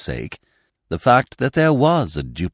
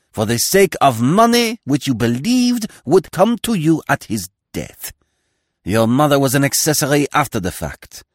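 high, loud accusing tirade; denouncing; 4 semitones higher, 4 dB louder than usual. Acted. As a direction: build a righteous denunciation. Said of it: "working up his period".

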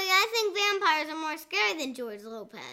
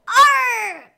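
loud mock growl of frustration; frustrated growl; 4 semitones higher, 10 dB louder than usual. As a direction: growl it out like a sulky kid. Frustrated outburst after the complaint.

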